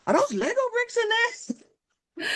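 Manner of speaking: stretched-out, very high incredulous question; mock amazement. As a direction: imitate a stunned security screener, slow squeal. Imitating amazed airport scanner staff.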